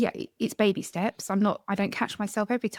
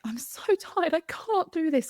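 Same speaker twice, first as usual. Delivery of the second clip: higher, worn-out moan; weary whine; 6 semitones higher, slower than usual. Retold or imitated. Mum overwhelmed by homeschool, whining higher and defeated. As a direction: sound defeated and tired. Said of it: "I start getting shaky and I'm like".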